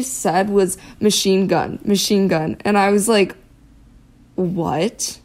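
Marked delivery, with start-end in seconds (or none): none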